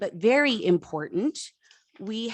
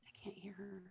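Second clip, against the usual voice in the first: whispering; 2 semitones higher, 26 dB quieter than usual. Real, not acted. Chair aside during vote.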